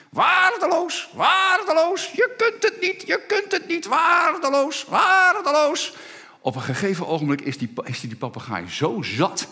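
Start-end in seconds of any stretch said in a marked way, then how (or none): 0.1-6.0 very high, loud parrot screech; squawking parrot. Pretend. Parrot mocks the repairman from a distance, shrill squawk. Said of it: "hij hoort continu".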